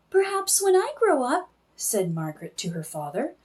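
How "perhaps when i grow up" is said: put-on young girl voice. Child Margaret voiced in story.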